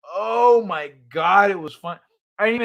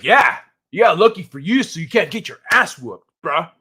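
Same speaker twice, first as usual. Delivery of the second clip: loud, cocky mock comeback; mock tough taunt; 5 dB louder than usual. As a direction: act out a chest-puffing chat threat. Imagined angry comeback in chat.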